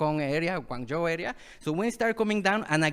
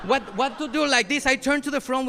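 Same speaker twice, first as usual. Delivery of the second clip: usual speaking voice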